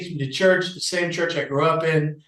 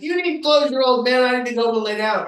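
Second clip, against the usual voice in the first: much higher, fast exasperated comeback; imagined snappy retort; 7 semitones higher than usual. Pretend. Son's unspoken retort to his dad, fast and exasperated. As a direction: fire back what you wish you'd said. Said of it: "But I didn't say that".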